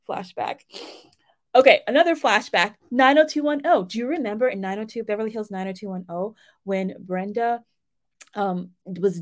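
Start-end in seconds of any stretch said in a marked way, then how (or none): none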